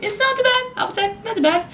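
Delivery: very high, squeaky-voiced verdict; playful falsetto. Vlogger rating the horn for viewers, in falsetto. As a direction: jump up into a silly falsetto.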